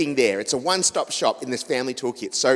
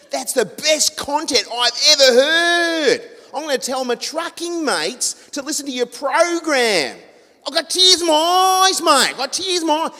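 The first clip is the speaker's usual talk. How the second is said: much higher and louder, slowed emotional bloke voice; gushing trucker fan; 13 semitones higher, 8 dB louder than usual. Retold or imitated. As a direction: rave like a trucker moved to tears. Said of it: "He goes".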